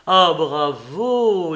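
loud, very drawn-out bravo; delighted cheer. A narrator's reading. Hostess applauding the young savant's consent, delighted cheer. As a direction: applaud with a long, gushing exclamation.

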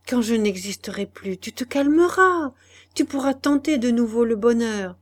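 high, loud desperate pleading; Madeleine begging. Acted. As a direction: beg him to spare you. Madeleine begs Guillaume. (supplication désespérée, voix haute et forte)

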